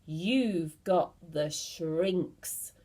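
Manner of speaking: drawn-out, very slow pronouncement; slow ominous cry. Acted. Mr Twit frightening his wife, slow and ominous. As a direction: stretch each word out to scare her. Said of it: "cried Mister Twit".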